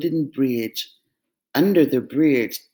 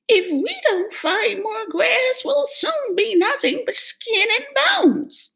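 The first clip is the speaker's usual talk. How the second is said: high, worried goat voice; fretful middle goat. Acted. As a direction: sound anxious and small.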